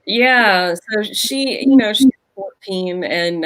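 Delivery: ordinary talk, nothing marked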